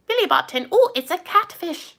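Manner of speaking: high, hammed-up Scottish accent; bad Scottish accent. Trying British, landing on a hammed-up high Scottish accent. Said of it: "No, that's a, like, Scottish".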